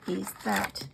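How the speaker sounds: ordinary talk, nothing marked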